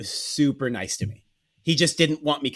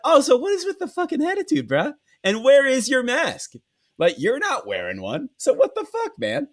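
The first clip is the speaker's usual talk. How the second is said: much higher, incredulous mock rant; mock outrage; 12 semitones higher than usual. Guest confronting the maskless clerk, hammed-up outrage. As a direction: ham up your outrage at him.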